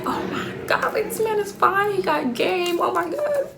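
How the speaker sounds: high, breathy-started gushing over him; inner swoon. Retold or imitated. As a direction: gush like you can't believe how fine he is. Inner swoon over fine man.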